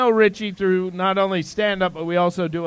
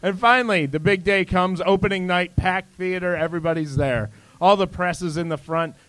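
ordinary talk

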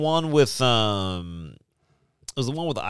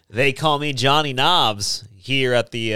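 ordinary talk, nothing marked

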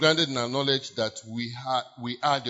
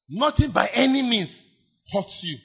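proclaiming; 8 semitones higher, 5 dB louder than usual. Acted. Preacher repeating the promise loudly.

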